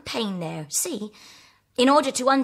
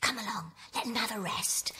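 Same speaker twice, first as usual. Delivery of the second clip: soft whisper so as not to wake him; 12 dB quieter than usual. Acted. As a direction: breathe it out without voice. Leaving the sleeping General quietly.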